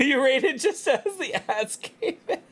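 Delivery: slow, high words through laughter; laughing. Real, not acted. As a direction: laugh while apologizing. Laughing apology to raider.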